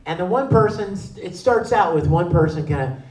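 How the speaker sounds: usual speaking voice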